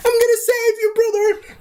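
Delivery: very high, squeaky hammed-up voice; squeaky falsetto. Brother rushes in, squeaking high. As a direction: squeal it like a cartoon. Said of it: "the other guy's like".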